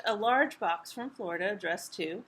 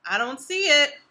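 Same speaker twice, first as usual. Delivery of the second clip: exasperated call; 2 semitones higher, 9 dB louder than usual. Acted. Bert searching for bolt.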